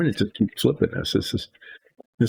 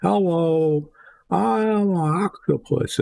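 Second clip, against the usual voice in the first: much higher, silly cartoon octopus voice; squeaky octopus; 9 semitones higher, slower than usual. Crafter voicing her painted octopus, high and silly. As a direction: pitch it way up like a tiny sea creature. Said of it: "it'll probably sound more like".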